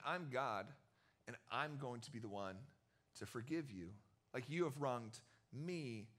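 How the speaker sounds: a low, gentle Jesus, slowing at the end; lightly voiced Jesus offering grace; Jesus claiming grace.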